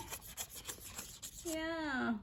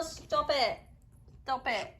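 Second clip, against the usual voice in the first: ordinary delivery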